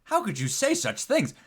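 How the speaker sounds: high-pitched scandalized reproach; mock-shocked clergyman scolding a nun; shocked bishop. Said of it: "is shocked".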